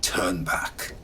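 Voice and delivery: usual speaking voice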